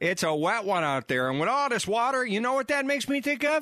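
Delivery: exaggerated Canadian hoser voice; Canadian hoser accent. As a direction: play a dopey Canadian brother. Bob and Doug McKenzie style ad.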